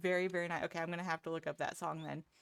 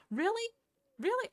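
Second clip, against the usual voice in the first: high, loud incredulous question; surprised disbelief; 14 semitones higher, 5 dB louder than usual. Real, not acted. Streamer hears a viewer knock the Aussie chant, high incredulous surprise. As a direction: pitch it way up in disbelief.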